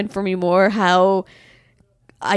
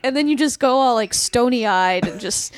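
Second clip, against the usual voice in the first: normal talking voice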